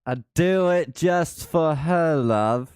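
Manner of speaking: high, loud, slow British crooning; mock British accent. Teasing chat about Missus Alder, mock British croon. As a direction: lay on a silly British charm. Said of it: "Missus Alder is gonna kill you".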